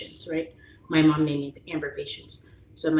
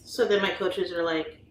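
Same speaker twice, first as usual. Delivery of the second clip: normal talking voice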